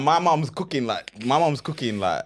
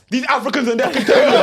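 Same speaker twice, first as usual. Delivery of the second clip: laughing; 9 semitones higher, 5 dB louder than usual. Retold or imitated. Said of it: "I just heard him say".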